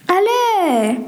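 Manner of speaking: high, stretched wheedling; pleading. Acted. Pleading again after mother's refusal.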